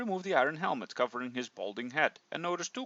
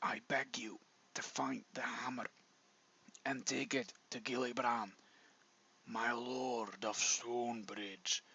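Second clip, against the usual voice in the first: whispered, much softer, slowing to a last breath; fading dying whisper; 3 semitones lower, 12 dB quieter, slower than usual. Acted. Dying dwarf begging the hero, fading to nothing. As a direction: plead slowly, running out of breath. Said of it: "in a low whisper".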